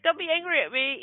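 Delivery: high-pitched mock plea; retold pleading. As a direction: plead to be let off the hook.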